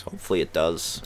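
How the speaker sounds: ordinary talk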